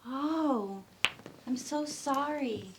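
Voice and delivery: ordinary talk, nothing marked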